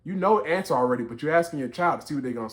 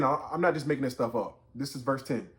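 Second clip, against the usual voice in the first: ordinary talk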